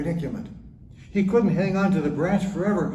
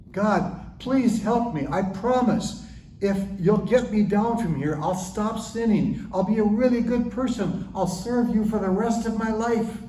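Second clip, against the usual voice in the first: desperate pleading; 7 semitones higher than usual. Acted. Jack pleads with God.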